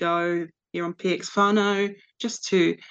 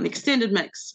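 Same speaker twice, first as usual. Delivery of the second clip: ordinary talk, nothing marked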